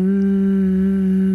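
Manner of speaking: held closed-lip "mm"; imitated baby hum. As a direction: hum a long, level "mm" like a baby. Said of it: "making the sound".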